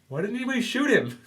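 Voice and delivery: a baffled cop shrieking his question; panicked bystander. Retold or imitated. Cop baffled that nobody shot Iceman, high squeak.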